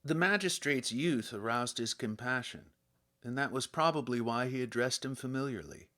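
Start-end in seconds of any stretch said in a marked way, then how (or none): none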